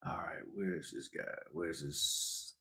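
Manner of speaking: whispered search under his breath; a whisper while looking for a file; whispering to himself. Real, not acted. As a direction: murmur it to yourself while hunting for something. Searching screen, talking to himself.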